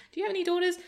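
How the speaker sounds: very high imitated child voice; little girl. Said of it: "she went back to it and goes".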